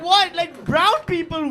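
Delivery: ordinary talk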